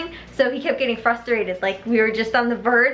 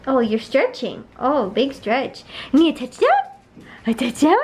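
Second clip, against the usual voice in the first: teasing dog talk; 4 semitones higher than usual. Retold or imitated. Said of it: "He was just like".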